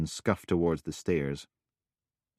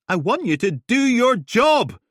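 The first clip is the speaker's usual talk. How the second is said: very loud, high, cutting; shouting; 10 dB louder than usual. Acted. Helen yelling at Steele, very loud. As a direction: yell the demand at her.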